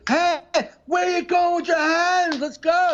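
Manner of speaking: high, stretched-out frontman holler; imitated stage shout. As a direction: bellow at the crowd like a hammed-up rock singer. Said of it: "he did the same act outs".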